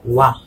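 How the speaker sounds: "wa" as one gliding syllable. Shown as a demonstration. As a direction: say "wa" as the French oi sound. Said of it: "whenever you are having O E".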